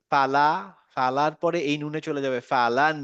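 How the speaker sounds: ordinary delivery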